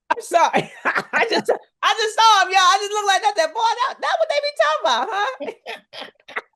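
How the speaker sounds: giggling high through the words; can't stop laughing at yourself. Real, not acted. Laughs at herself after bragging.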